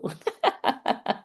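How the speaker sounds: high, breathy cackling laugh; witchy cackle. Real, not acted. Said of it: "that almost sounded like a witch there".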